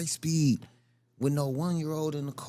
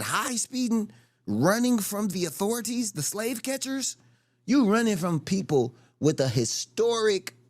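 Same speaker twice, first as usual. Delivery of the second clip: loud, rising, outraged; heated rant; 4 semitones higher, 4 dB louder than usual. Real, not acted. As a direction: push it louder into outrage. Indignant rant about police.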